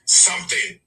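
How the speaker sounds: ordinary talk, nothing marked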